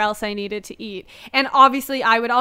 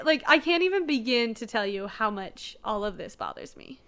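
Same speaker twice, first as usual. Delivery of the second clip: ordinary talk, nothing marked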